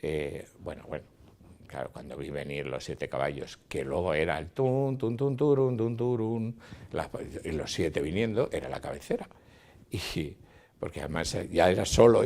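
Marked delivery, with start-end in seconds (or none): 4.5-6.6 higher, rhythmic chanted hoofbeats; imitated hoofbeats. Director recalls seven horses coming at the camera, imitated gallop.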